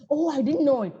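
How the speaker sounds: bright rise on Oh, a real surprise; emphatic surprised "Oh, I didn't know it"; surprised exclamation. Shown as a demonstration. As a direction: gasp out "Oh" as if truly caught off guard. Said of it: "You don't say".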